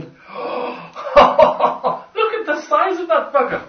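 high, slow laughing amazement; laughing awe. Real, not acted. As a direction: marvel at it through laughter.

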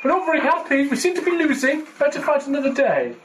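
high-pitched put-on grumbling soldiers; mock reluctant troops. Player voicing troops losing a war game, mock reluctant.

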